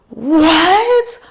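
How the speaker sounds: high, loud shocked gasp of disbelief; shocked boss. Retold or imitated. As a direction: squeal it like the boss can't believe it. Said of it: "That's how she said it".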